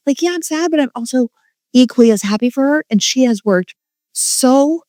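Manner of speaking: real high-pitched, emotional insistence; emotional screaming. Real, not acted. Proud mom on how hard her daughter worked, high and emotional. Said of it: "Sorry, I'm screaming at you".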